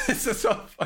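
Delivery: high-pitched, laughing as you speak; real laughter. Real, not acted. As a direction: let the laugh break into the words. Amused, laughs while saying it.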